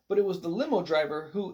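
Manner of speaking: plain reading voice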